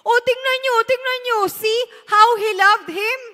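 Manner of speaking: preacher voices the amazed crowd watching Jesus weep; amazed onlookers. Acted. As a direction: marvel aloud at how much he loved him. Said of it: "Sabi ng mga Hudyo".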